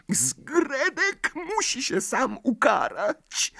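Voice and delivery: high, slow elf voice; tiny creature announcing gravely; squeaky house-elf. A narrator's reading. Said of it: "oznajmił Duszek". (piskliwy głos skrzata)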